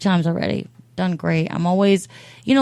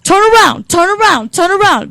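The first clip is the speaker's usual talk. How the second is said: mock yell; 12 semitones higher, 7 dB louder than usual. Yelling at her man.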